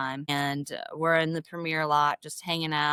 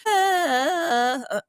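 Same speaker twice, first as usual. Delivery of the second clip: tearing up; 11 semitones higher, 6 dB louder than usual. Real, not acted. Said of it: "And I start bawling".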